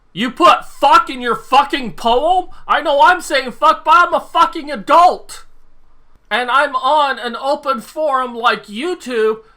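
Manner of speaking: high, loud, incredulous rant; indignant outburst. Real, not acted. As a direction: raise your voice in outraged disbelief. Outraged reaction to a child swearing.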